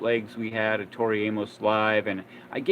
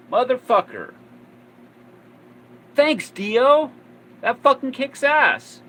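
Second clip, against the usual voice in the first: high, loud, slow swearing delight; thrilled outburst; 14 semitones higher, 10 dB louder, slower than usual. Retold or imitated. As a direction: cheer like you just won. Thrilled reaction to Dio's call.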